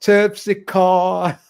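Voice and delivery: Terpsichore drawn out slowly as "Terp-si-core"; slow, drawn-out [name]-style Terpsichore; [name]'s pronunciation. Retold or imitated. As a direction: stretch Terpsichore the way [name] sang it. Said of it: "as [name] calls it".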